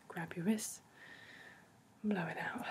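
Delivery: breathy whispered release cue; whispered breath cue. Shown as a demonstration. Coach whispering a tapping cue to a listener. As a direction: whisper the client through the out breath.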